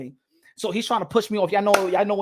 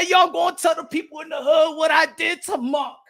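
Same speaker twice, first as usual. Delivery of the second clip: excited boast; 6 semitones higher than usual. Retold or imitated. Young [name] gloats about smacking Mark, excited shout. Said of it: "I said".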